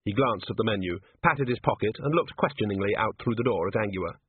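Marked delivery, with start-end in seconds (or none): none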